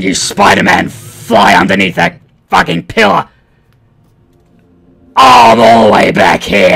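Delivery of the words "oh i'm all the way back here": frustrated shout. Real, not acted. Frustrated after dying.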